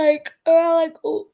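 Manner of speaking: words giving way to a yawn; talking through a yawn. Real, not acted. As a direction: start the sentence, then yawn through it. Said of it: "I need to finish yawning".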